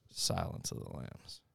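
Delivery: whispered horror title; low, voiceless announcement; creepy hush. Acted. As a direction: whisper it like a scary reveal. Announcing the horror movie match-up.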